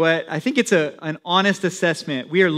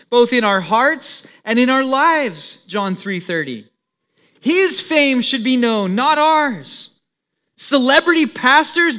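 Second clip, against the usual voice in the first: a bit louder, firm declamatory read; reading a statement aloud; 5 semitones higher, 3 dB louder than usual. Acted. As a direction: read the quoted message with conviction. Reads out a quoted message.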